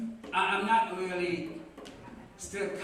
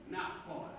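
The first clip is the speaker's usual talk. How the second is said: muttered aside; 8 dB quieter than usual. Real, not acted. Joke aside.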